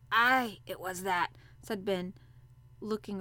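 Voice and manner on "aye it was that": put-on old Yorkshire gardener. A narrator's reading.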